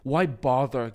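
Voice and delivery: ordinary delivery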